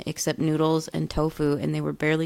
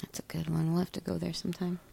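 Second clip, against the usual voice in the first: hushed unvoiced aside; whisper; 12 dB quieter, faster than usual. Real, not acted. Friend quietly recommending a restaurant, whispered. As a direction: whisper it like a secret tip.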